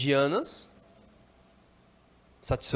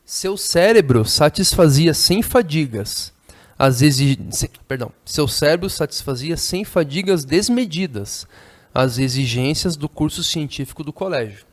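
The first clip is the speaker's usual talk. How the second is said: reading a novel aloud; 8 dB louder than usual. Acted. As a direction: declaim the passage to the class.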